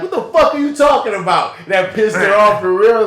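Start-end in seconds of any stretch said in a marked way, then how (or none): 0.0-1.5 high, loud acted outburst; mock yell. Acting out the bedroom scene with Charlotte, yelling high and loud. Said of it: "and I'm like".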